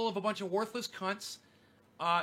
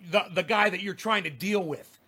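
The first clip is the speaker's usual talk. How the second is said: ordinary talk, nothing marked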